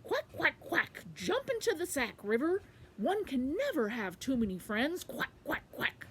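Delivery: high, loud quacking duck. Acted. Quackling welcoming the river into the sack, high loud duck voice. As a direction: proclaim it between quacks. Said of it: "so he said".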